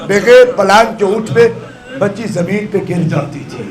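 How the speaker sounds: normal talking voice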